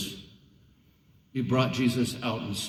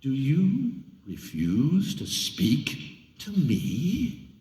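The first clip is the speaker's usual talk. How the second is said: slow, measured menace rising high; threatening; 6 semitones higher, 3 dB louder, much slower than usual. A narrator's reading. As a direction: lean on each word, power in your hand. Pilate threatens silent Jesus.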